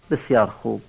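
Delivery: ordinary talk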